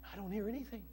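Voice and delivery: small, hushed voice; doubtful listener. Acted. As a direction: drop to a soft aside as the doubter. Acts out the unhearing listener.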